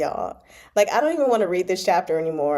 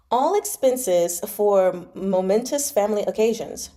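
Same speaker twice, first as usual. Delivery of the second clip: plain reading voice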